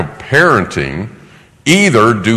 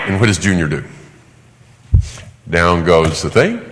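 ordinary talk, nothing marked

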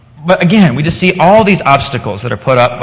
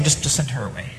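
much softer, brushing her off; dismissive aside; 4 semitones lower, 13 dB quieter than usual. Acted. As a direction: toss it off half under the breath. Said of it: "the very disciples are saying".

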